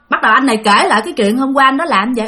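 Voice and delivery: normal talking voice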